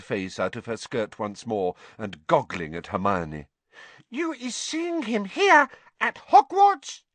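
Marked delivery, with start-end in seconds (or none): 4.1-7.0 high, louder elf voice, eager; eager house-elf. A narrator's reading. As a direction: elf hungry for news. Excited elf question.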